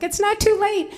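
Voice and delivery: high-pitched mock mother's insistence; nagging mother.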